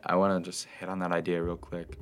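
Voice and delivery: normal talking voice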